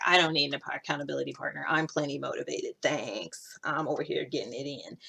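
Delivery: low, soft mock brush-off; dismissive. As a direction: wave it away under your breath. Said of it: "sometimes I poo-poo that".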